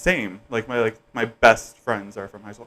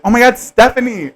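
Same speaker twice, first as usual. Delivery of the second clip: high, louder re-enacted squeal of recognition; excited greeting; 10 semitones higher, 6 dB louder than usual. Friend retelling spotting her on the street, excited greeting. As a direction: act out being thrilled to spot a friend. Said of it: "I was so excited to see you".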